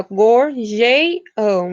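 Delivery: very slow "gorjeiam" with its vowels joined; escansão bem lenta. Shown as a demonstration. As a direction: drag out "gorjeiam", gluing the vowels. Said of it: "a gente junta as vogais e a gente conta". ("gorjeiam" bem devagar, juntando as vogais)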